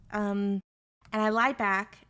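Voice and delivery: normal talking voice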